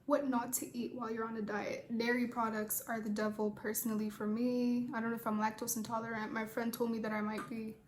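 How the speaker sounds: normal talking voice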